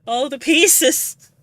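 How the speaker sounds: tearful cry, slow and drawn out; overwhelmed, voice full of tears. Real, not acted. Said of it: "I'm crying".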